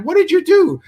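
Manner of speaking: high, loud mock outburst; loud, high-pitched retold confrontation; acted exasperated demand. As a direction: demand an explanation, voice shooting up. Confronts neighbor over flooded apartment.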